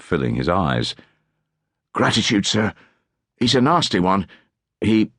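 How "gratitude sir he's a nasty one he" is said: put-on a little higher, eager child voice; lightly voiced eager urchin; grateful small boy. A narrator's reading. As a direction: thank him like an adoring street kid. Twiglims thanking Tallis.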